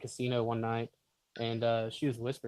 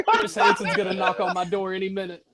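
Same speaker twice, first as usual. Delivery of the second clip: louder, higher, through laughter; laughing joke; 5 semitones higher, 7 dB louder than usual. Real, not acted. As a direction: toss in the punchline while cracking up. Joking over the group's laughter.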